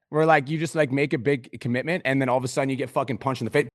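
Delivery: ordinary talk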